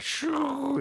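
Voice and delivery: high whooshing "shoo"; whoosh sound. Retold or imitated. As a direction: imitate lines shooting wildly upward. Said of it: "And the lines went just, like".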